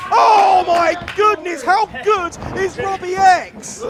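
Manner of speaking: wrestling commentator. Real, not acted. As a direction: shout the big move like a commentator. Excited wrestling commentary call.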